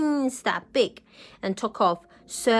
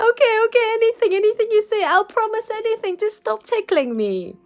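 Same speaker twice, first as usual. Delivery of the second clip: high, fast, loud through laughter; giggling desperate dragon; laughing plea; 11 semitones higher, 5 dB louder, faster than usual. Acted. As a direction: gasp out promises while tickled.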